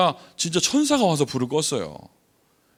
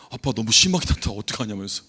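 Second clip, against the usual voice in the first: normal talking voice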